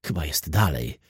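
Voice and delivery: ordinary delivery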